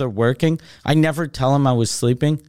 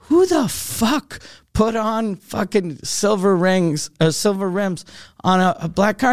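retold anger; 4 semitones higher than usual. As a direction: confront the guy, stretching the words. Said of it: "So I'm super angry".

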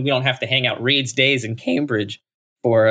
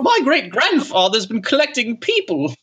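snooty British toff; 13 semitones higher than usual. Pretend. Continuing snooty toff impression.

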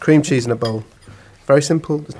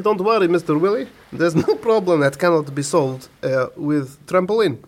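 mock Polish builder's accent; 3 semitones higher than usual. Builder reassuring his client about the garden, in a mock Polish accent. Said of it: "to do the accent".

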